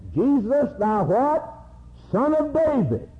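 high preacher's call-out; acted high cry, prompting the congregation; recalled beggar's cry. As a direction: pitch it way up, calling out the title. Preacher recalls blind man's cry.

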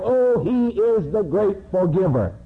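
rapturous condemned man; martyr's cry. Acted.

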